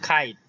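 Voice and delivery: "খাইট" as English kite with a puffed k; airy burst on the k of "খাইট"; aspirated K. Shown as a demonstration. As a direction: say "খাইট" with a kh onset. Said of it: "এটা খ উচ্চারণ নিয়ে আসবে".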